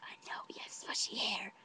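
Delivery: hushed, whispery confiding; whispered agreement. Retold or imitated. Agreeing with her friend about a boy's hair, whispered. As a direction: whisper it like a shared secret. Excited secret aside to friend.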